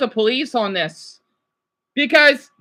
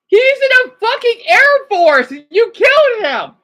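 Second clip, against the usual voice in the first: high, loud outraged shout; heated shouting; 11 semitones higher, 4 dB louder than usual. Real, not acted. Streamer shouts at the killer officer, outraged and heated. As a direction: explode at the news, outraged. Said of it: "I got a little heated".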